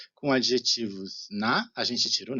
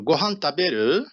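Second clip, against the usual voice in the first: "Gohan taberu" louder, rising at the end of "taberu"; question intonation; 3 semitones higher, 5 dB louder than usual. Shown as a demonstration. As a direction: ask "Gohan taberu", lifting the last syllable. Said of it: "Com a entonação a gente faz a pergunta". ("Gohan taberu" como pergunta, subindo no fim)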